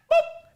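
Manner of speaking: playful high boop. Real, not acted. Playful sound effect.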